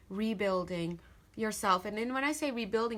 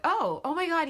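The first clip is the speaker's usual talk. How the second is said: high, loud acted "oh my God"; sudden realization; 8 semitones higher, 7 dB louder than usual. Mimicking someone suddenly recalling a forgotten ex, surprised. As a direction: act out remembering with a jolt.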